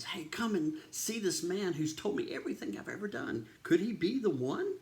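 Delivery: high, excited retelling of urgent news; excited summons. Retold or imitated. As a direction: act out calling the townsfolk over, voice lifted high. Woman runs to town calling people.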